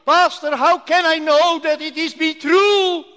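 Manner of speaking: high, sustained calling out from afar; loud cry. Retold or imitated. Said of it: "she cried very loudly".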